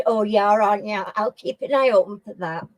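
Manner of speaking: normal talking voice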